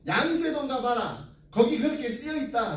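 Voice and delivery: retold Gyeongsang reply with a strong lilt; Gyeongsang dialect. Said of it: "이게 어디 사투리인가요? 경상도인가요?".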